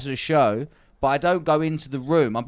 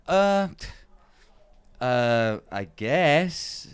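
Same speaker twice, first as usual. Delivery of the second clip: slow, hesitant, unwilling "I guess"; mock reluctant mumble; reluctant hesitant reply; 4 semitones lower, much slower than usual. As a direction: drag it out like you don't want to go. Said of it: "That's how he said it".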